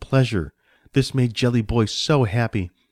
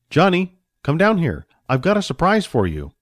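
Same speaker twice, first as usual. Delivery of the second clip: raised, higher fatherly call; 7 semitones higher, 4 dB louder than usual. A narrator's reading. Father calls Johnny down for a surprise, calling out. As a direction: call the boy down, name pitched up. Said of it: "the man called out".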